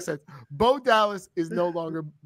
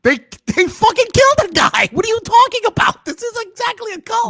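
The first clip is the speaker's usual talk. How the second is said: shouting; 9 dB louder than usual. Real, not acted. Heated argument over a cult.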